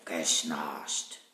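a low, drawn-out snoring sound; deep snore. Acted. Kasperl mimics Seppel snoring over his lantern.

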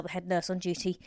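ordinary delivery, nothing marked

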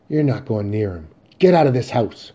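loud, forceful order; angry forbidding. Acted. Mother keeping son away from his father, angry order.

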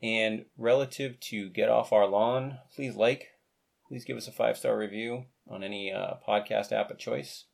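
ordinary talk, nothing marked

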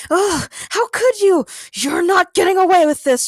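loud, high outrage; snarled. A narrator's reading. Trapped Sierra defies the one who tricked her, snarling. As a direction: snarl it in defiance at your captor. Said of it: "she snarled".

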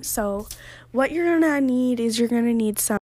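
ordinary delivery

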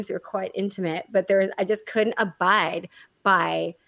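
ordinary talk, nothing marked

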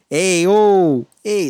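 high, loud "ay-oh" holler; construction guy. Retold or imitated. Overweight slick-haired worker greeting the guys, loud mock holler. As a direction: hail the crew with a drawn-out shout. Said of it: "I just know he's the A O guy".

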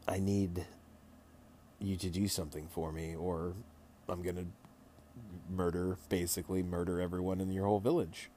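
soft, low, calm mock threat; a quiet menacing queen, barely above a murmur; fairy queen.

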